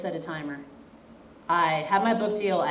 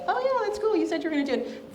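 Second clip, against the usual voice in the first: high, breezy imitation of proud parents; mildly impressed parents; 12 semitones higher, faster than usual. Author imitating her parents' casual praise, high and breezy. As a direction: toss it off like a shrugging parent. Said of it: "but it's more of like".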